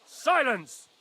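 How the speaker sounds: loud high bark of command; shouted command. Acted. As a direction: silence the room at once. Lord silences the protester.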